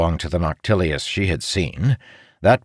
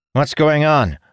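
demanding; 8 semitones higher, 3 dB louder than usual. A narrator's reading. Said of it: "the lieutenant demanded".

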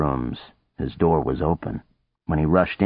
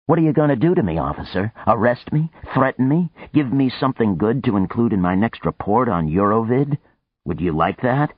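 mocking taunt; 7 semitones higher than usual. Boone taunting Houston.

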